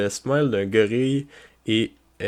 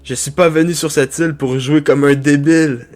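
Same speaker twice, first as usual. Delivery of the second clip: louder, higher threatening snap; angry Zoro; 3 semitones higher, 6 dB louder than usual. Acted. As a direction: warn him off, getting angry. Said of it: "Zoro commence à se fâcher".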